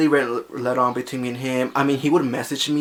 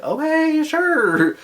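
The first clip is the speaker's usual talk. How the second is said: much higher, drawn-out unwilling okay; reluctant high okay; 5 dB louder, much slower than usual. Retold or imitated. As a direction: squeak out a hesitant yes. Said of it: "and I'm like".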